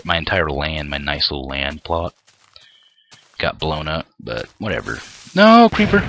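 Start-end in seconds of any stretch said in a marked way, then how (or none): 5.3-6.0 high loud yell of alarm. Real, not acted. Spotting a creeper, a high startled yell. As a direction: shout as the creeper shows up.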